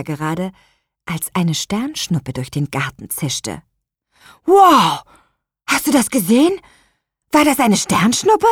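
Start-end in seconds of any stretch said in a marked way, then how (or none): 4.4-8.5 high, loud amazed call; excited call. Acted. Luis spots a shooting star from the window, excited call. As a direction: blurt it out, thrilled by the shooting star. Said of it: "rief Luis".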